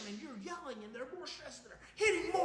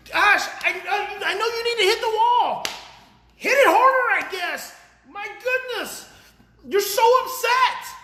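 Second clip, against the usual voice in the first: mock loud soothing parent; 8 semitones higher, 8 dB louder than usual. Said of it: "if you were instead saying".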